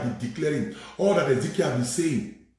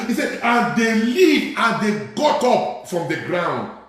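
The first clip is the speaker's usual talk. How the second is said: raised, rousing pulpit delivery; full-force preaching; 9 semitones higher, 6 dB louder than usual. Real, not acted. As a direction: proclaim it high to rouse the congregation.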